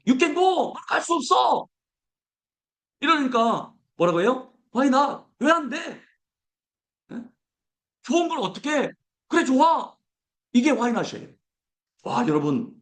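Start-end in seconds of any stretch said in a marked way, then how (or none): 0.1-1.7 high mock shouting crowd; shouted refusal. Voicing people refusing, shouting. 4.8-10.0 high, keen acceptance; eager why not. Pretend. Voicing Joshua and Caleb.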